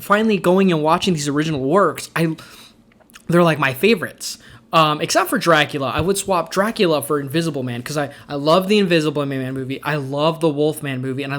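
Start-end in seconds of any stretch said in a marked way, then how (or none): none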